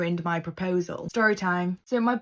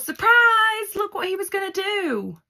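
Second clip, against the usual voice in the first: very high, hammed-up squeal; mock excited yell; 13 semitones higher, 6 dB louder than usual. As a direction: shriek the reveal like a sister stealing the moment, easing in volume. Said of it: "and yells".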